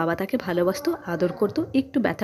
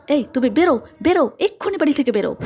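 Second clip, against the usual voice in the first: higher, urgent and forceful; angry order; 5 semitones higher, 4 dB louder than usual. A narrator's reading. Mother dragging girl to the door, angry order. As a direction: drive her out with quickening commands.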